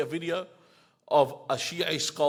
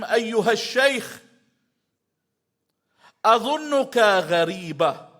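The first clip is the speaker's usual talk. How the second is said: ringing declaration; 6 semitones higher, 7 dB louder than usual. Retold or imitated. Imam answering the insulter.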